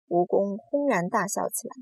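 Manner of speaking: ordinary delivery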